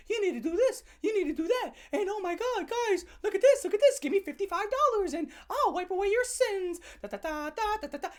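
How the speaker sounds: high, sing-song preachy voice; high mock sales pitch; mocking preachy nun. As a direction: mimic a pushy churchwoman selling forgiveness. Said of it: "some nun to tell you".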